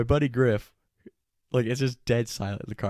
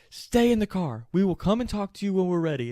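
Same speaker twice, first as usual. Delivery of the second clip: high, forceful imitation of police command; shouted order; 9 semitones higher, 4 dB louder than usual. Cops tell Griff to wait in the car, shouted order. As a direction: lay down the law from a distance.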